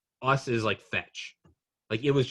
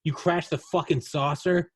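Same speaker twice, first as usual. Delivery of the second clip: higher, louder chewing out; scolding parent; 5 semitones higher, 4 dB louder, slower than usual. Retold or imitated. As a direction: scold them like an angry dad. Alien parents scolding kids.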